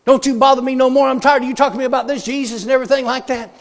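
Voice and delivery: high, loud, fast rant; worked-up imitation of a furious inmate; furious outburst. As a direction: act out an angry man telling someone to back off. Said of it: "He was furious".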